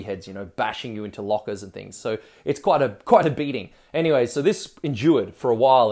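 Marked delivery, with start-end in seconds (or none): none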